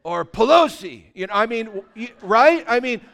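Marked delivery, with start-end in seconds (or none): 0.0-1.0 acted outcry after a hurt; hammed-up exclamation of pain; mock pained yell. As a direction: yell it high and loud like a mock curse. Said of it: "you scream that out".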